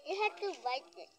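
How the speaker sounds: ordinary talk, nothing marked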